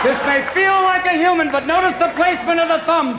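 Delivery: high, loud excited squeaky voices; hammed-up squeaky shout; talking balls. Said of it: "my balls went".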